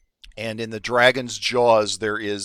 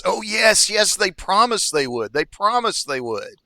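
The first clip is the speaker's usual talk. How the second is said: higher-pitched, eager little kobold; excited kobold; 9 semitones higher, 4 dB louder than usual. Acted. Meepo assures his queen the strangers promised, excited. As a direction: jump up and down with eager agreement. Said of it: "Meepo jumps up and down and says".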